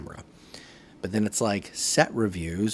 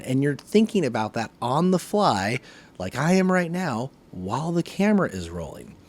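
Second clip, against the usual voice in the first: normal talking voice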